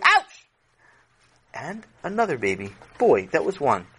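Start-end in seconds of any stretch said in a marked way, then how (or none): 0.0-0.5 sharp, high, loud cry of pain; pained yelp. Acted. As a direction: yelp as if your hair is pulled. Victim cries out in pain.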